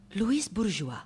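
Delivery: very soft, careful naming; quiet, tentative start; hushed hesitation. Acted. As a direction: say the name under your breath. Interpreter hesitantly breaks the news.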